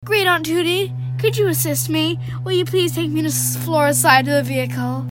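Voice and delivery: put-on lively read-aloud of a children's novel; story reading. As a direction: read the chapter to your viewers with energy. Reads book excerpt aloud.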